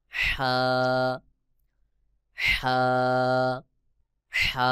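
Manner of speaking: হা with strong friction from mid-throat, held long; letter demonstration. Shown as a demonstration. Teacher shows Arabic ha, হা from mid-throat. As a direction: push হা out of the middle of the throat. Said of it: "গলার মধ্যখান থেকে হা উচ্চারণ হবে".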